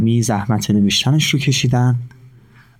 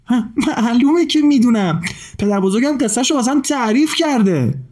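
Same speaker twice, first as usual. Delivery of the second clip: put-on eager boy; 11 semitones higher than usual. A narrator's reading. Boy eagerly answers bird.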